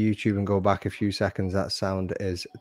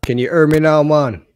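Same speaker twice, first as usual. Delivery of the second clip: louder and higher, hammed-up test call; loud mic check; 6 semitones higher, 10 dB louder than usual. Streamer mocking his guest's broken mic check, loud and high.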